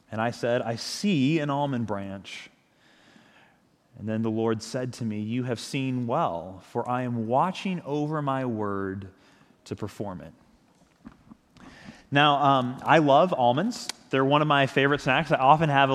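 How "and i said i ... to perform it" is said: scripture reading. Acted. Reading the scripture passage aloud.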